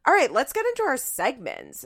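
mock host cutting off the fun; podcast host voice. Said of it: "suddenly they're like".

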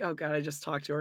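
low, soft imitation of regret; disgusted. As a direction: voice his disgust under your breath. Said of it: "he looks disgusted".

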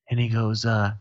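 normal talking voice

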